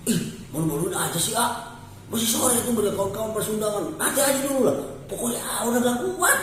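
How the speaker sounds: put-on high, coy young bride protesting; bride's voice. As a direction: squeal it shyly as the bride. (suara pengantin perempuan yang malu-malu, tinggi)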